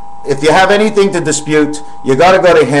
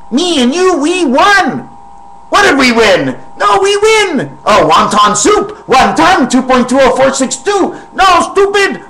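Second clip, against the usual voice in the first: much higher put-on voice, getting shouty; squeaky other persona; 11 semitones higher than usual. Imaginary partner argues with the speaker, much higher squeaky voice. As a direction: bicker as the high-voiced partner.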